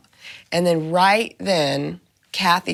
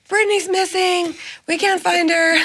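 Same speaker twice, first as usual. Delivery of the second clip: high-pitched boys blurting bad news; panicky kids imitated; 12 semitones higher, 3 dB louder than usual. Boys greeting dad as he walks in, high imitated alarm.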